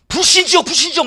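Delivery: 높고 크게 불신지옥을 외치기; 남편에게 달려들듯 높이 소리쳐; 덤비는 외침. Retold or imitated. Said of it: "막 이러고 나한테 덤볐으면요". (전도하려 남편에게 덤비는 아내의 높은 외침)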